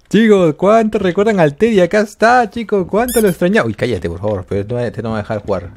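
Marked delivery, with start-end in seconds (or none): 0.1-3.7 excited outburst. Real, not acted. Gamer telling viewers that Teddy is back, excited outburst. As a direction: call out to viewers, stretching the words.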